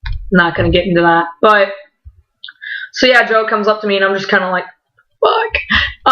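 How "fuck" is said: tiny high-pitched swear; high squeaked curse, retold; starstruck squeak. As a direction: let it slip out high and small. Retold moment meeting idol, squeak.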